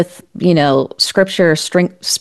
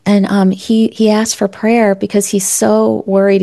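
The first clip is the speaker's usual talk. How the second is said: ordinary delivery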